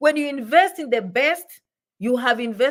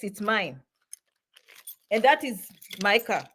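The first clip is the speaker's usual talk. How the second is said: ordinary talk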